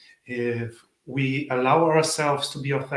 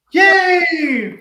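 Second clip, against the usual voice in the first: much higher, loud celebratory shout; mock cheer; 10 dB louder than usual. Real, not acted. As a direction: cheer like you just won something. Host mock-celebrates first spammer.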